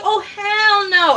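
mock outraged shout. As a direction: draw it out like a flat no. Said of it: "I was like".

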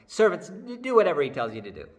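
loud, quick motherly command; a pushy mom overriding her son; bossy mother. Acted. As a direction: boss the servants around, fast and loud. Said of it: "She's like".

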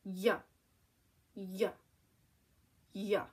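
"Y" as a short yuh, not the letter name; isolated yuh for each "Y", spaced out; letter sound. Shown as a demonstration. Teacher modelling Y at a word's start, short letter sound. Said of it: "what sound would that make".

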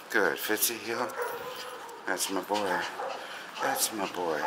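soft, low-key praise; calming. Shown as a demonstration. Trainer turning dog's energy into calm, soft and soothing. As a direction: quietly soothe the dog. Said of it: "I've gotta turn it into this".

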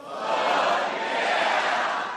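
crowd yelling back; audience shout. Shown as a demonstration. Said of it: "let's try it one time".

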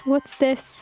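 normal talking voice

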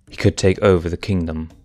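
ordinary delivery

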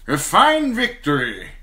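put-on hearty older knight, pleased; lightly voiced approving knight; knight's voice. A narrator's reading. Sir Kevan voiced in narration.

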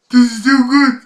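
talking while dancing along; words bounced out mid-dance. Real, not acted. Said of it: "I could stop dancing".

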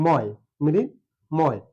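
মৃন and ময় as separate beats, "mrin... moy"; split into syllables. Shown as a demonstration. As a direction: halt after each of মৃন and ময়. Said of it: "এখান থেমে গেছি". (থেমে থেমে "মৃন্... ময়্")

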